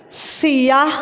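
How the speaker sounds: ordinary talk, nothing marked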